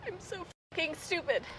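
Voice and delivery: high, strained, choked self-insult; self-directed anger. Shown as a demonstration. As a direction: force it out like it hurts to say. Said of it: "Say it with a volume you say it".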